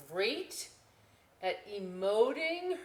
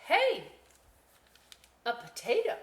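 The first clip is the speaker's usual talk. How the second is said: much higher, louder surprised call; 8 semitones higher, 7 dB louder than usual. Acted. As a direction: spot something odd and call out. Girl finds a potato.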